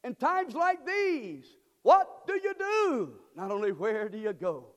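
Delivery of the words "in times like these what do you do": high, loud, slow preacher's cry; full-force preaching. Acted. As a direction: call it out slow and loud to the congregation.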